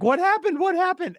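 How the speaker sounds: very high, urgent coworkers' questions; alarmed coworkers. Retold or imitated. Coworkers ask about injury.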